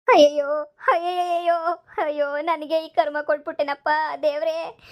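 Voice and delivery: drawn-out cries of grief; anguished wailing. Acted. Betrayed wife asking God why she suffers, wailing.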